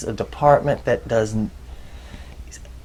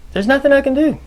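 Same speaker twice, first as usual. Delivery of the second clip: imitating her, much higher and louder; exasperated outburst; 5 dB louder, faster than usual. Neighbor complaining about her government employee, hammed-up exasperation. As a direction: throw up your hands in mock despair.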